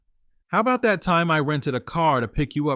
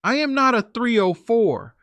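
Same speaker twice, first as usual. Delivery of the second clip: bawling; 8 semitones higher, 3 dB louder than usual. A narrator's reading.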